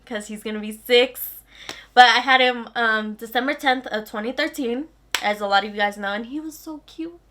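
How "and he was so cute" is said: gushing. Real, not acted. Gushing over newborn son.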